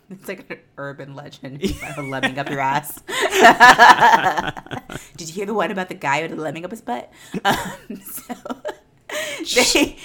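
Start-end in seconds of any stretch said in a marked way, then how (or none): 3.1-5.1 long loud burst of laughter; big uncontrolled laugh; loud belly laugh. Real, not acted. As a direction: crack up helplessly at a dirty joke. Cracking up at lemming joke.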